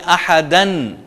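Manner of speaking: احدن said ahadan; nunation with an. Shown as a demonstration. Teacher showing double fatha with its n. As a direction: end on a clear an. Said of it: "زبر لگا دیں".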